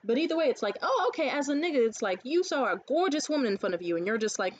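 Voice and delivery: ordinary talk, nothing marked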